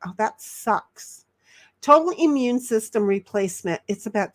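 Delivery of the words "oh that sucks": usual speaking voice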